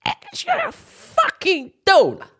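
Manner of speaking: high, drawn-out comic proclamation; mock verdict. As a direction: stretch it out high like an absurd announcement. Mock diagnosis from the doctor.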